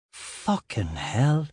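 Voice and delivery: a slow hungover groan of dismay; groaned curse. A narrator's reading. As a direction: drag it out in a pained groan. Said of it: "I groaned".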